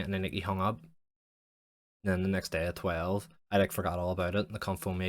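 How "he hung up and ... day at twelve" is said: usual speaking voice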